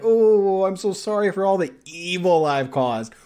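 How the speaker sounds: hammed-up remorseful whine; mock whining apology. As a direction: imitate a tearful serial apologizer. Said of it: "continuously apologize and cry and whine".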